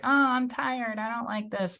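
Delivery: high-pitched put-on whine; high mock grumble of a tired quitter; mock complaining. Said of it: "common to just complain and be like".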